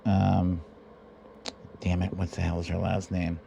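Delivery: ordinary talk, nothing marked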